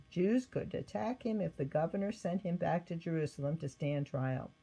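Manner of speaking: ordinary delivery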